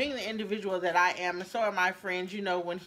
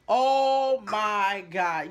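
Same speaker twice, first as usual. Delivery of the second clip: aghast; 6 dB louder, much slower than usual. Real, not acted.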